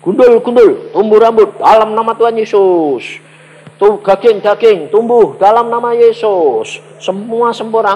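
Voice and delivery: high, loud commanding chant; loud declaration. Shown as a demonstration. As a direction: proclaim it so your own ears hear it. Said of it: "Banter, telingamu mesti denger".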